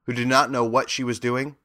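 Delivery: ordinary delivery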